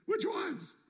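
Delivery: very high, amused mock question; mock loophole-seeking. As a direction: squeak it out as a hopeful dodge. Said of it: "Looking for loopholes".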